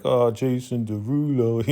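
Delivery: mock disappointed. Friend left out of the concert, lightly deflated. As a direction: let the name sink like the plan fell through. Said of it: "homeboy is disappointed".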